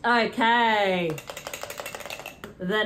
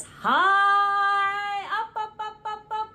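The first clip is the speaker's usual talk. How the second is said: rising up high; 8 semitones higher, slower than usual. Shown as a demonstration. Mimics plane flying up.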